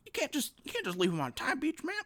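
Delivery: put-on lightly done Biden old-man voice; a hint of Biden cracking down; Joe Biden impression. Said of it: "Is, is that a decent Biden?".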